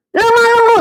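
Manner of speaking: "lemah lembut" jumping very high; deliberately high emphasis. Shown as a demonstration. Preacher waking drowsy listeners, high emphatic pitch on "lemah lembut". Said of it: "sengaja tuh saya buat intonasinya agak tinggi".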